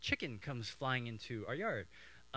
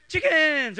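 high, loud drawn-out yelp; excited dog voice; 13 semitones higher, 13 dB louder than usual. Retold or imitated. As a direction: yell it like a dog spotting prey. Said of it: "My dog sees the chicken and goes".

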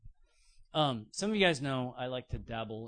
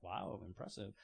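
much lower, softer dry "impressive"; dry sarcasm; 7 semitones lower, 12 dB quieter than usual. Retold or imitated. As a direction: fake being impressed. Unimpressed response to gear boasting.

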